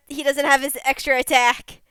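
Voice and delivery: ordinary talk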